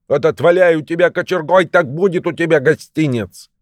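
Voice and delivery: high-pitched, threatening scold; scolding shout. A narrator's reading. Old wife threatens husband with a poker, scolding shout. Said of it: "закричала ему старуха".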